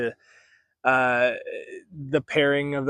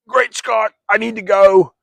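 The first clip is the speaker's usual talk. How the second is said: high, loud scientist voice with accidental Australian twang; Doc Brown impression; 9 semitones higher, 12 dB louder than usual. Professor learning of the volcano route, excited Doc Brown outburst. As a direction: exclaim like an excitable movie scientist, accent drifting Australian. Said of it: "like an Australian accent I'm doing".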